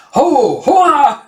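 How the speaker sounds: loud, high hollers; herding shouts. Retold or imitated. Man waves turban to scare the sheep, hollering. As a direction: act out driving animals off with big cries.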